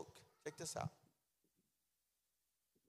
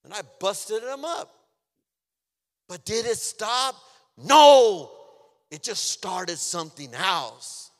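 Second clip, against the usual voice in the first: loud, high preaching with a shouted peak; impassioned preaching; 5 semitones higher, 17 dB louder, slower than usual. Real, not acted. Preacher testifying about fights to church, loud and forceful. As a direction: raise the voice, punching the answer hard.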